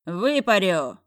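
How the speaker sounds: loud yell after her. A narrator's reading. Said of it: "проорал я вслед".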